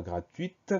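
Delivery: « gratuite » avec le T final bien sonné; T final audible. Shown as a demonstration. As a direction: faire claquer le T muet dans « gratuite ». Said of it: "Donc on entend ce T". (« gratuite », finale « uite »)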